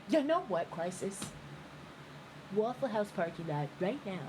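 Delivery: low, quiet mock threat; mock fight challenge. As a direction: drop low and issue the challenge.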